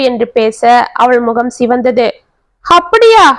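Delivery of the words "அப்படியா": high, shocked exclamation; startled cry of disbelief; alarmed. A narrator's reading. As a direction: react to bad news in alarm. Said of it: "என்று பதறினாள்".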